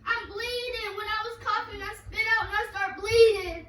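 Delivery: loud frantic pleading; panicked shouting. Acted. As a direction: shout it out, scared. Fake emergency, explaining in panic.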